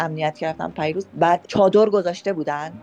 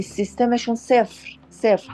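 1 scornful; 6 semitones higher than usual. Real, not acted. Scornful verdict on system.

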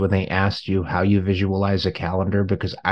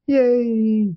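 high mock cheer; 11 semitones higher than usual.

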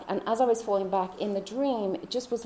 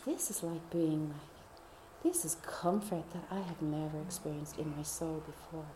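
awed whisper; 3 semitones lower, 8 dB quieter than usual. Retold or imitated. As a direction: whisper as if overwhelmed by comfort. Awed inner thought in the dream.

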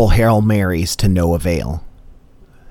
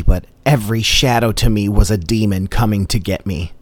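usual speaking voice

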